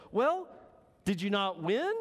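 high curious question, lightly voiced; a touch of puzzled man asking; voicing the man. Preacher voicing the man quizzing the dog, high and curious.

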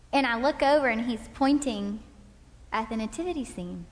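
ordinary talk

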